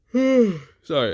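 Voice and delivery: usual speaking voice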